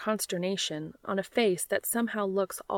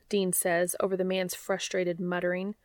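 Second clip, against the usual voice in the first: ordinary delivery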